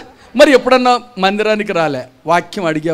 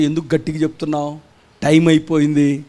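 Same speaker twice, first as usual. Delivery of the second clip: mock grumbling chairman; 6 semitones lower, 4 dB quieter than usual. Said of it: "వాళ్ళు ఏం చేస్తారో తెలుసా".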